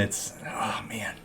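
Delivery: a soft unvoiced whisper, savoring it; gloating whisper. Real, not acted. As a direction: whisper it as if relishing a secret treat. Savoring their meltdown.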